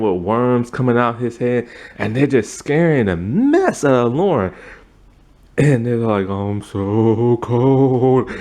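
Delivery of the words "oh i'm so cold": very slow, drawn-out ghost moan; ghostly moan. Pretend. Ghost moaning to Lauren about being cold, very slow and drawn out. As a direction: stretch every word like a cold spirit.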